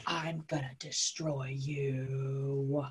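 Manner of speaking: low, hushed, drawn-out villain voice; very slow, deep mock-evil threat. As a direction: draw the threat out low and menacing. Villain Ratchet's threat acted out.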